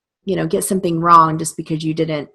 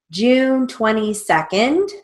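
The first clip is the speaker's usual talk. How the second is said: dictating; 5 semitones higher, slower than usual. Shown as a demonstration. Dictating dates.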